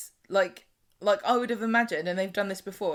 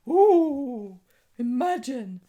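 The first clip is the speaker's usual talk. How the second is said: high, loud drawn-out ooh; exaggerated acted astonishment; mock gasping crowd; 5 semitones higher, 7 dB louder, much slower than usual. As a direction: react like a scandalised onlooker. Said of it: "everyone was kind of nicely spaced out, going".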